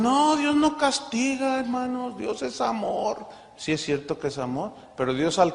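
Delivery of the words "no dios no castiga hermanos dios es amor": higher, slow, mock-sweet preachy voice; mocking quote. Mocking Christians who deny God punishes, slow mock-pious voice. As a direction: parrot them in a syrupy, pious tone. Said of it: "Hay cristianos que dicen".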